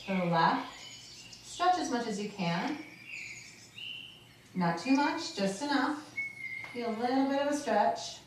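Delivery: soothing fitness coach; calm exercise instruction. Acted. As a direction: guide them through the stretches calmly. Leads a guided stretch.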